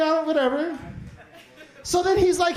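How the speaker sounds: ordinary talk, nothing marked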